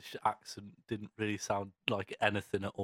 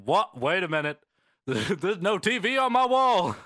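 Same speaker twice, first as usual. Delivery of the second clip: shocked double take; 7 semitones higher, 8 dB louder than usual. Retold or imitated. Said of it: "I was like".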